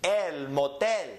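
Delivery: slow El motel, el mo-TEL; last-syllable stress. Shown as a demonstration. Teacher models for students to read, El motel slowly stressed on TEL. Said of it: "we're going up on the N".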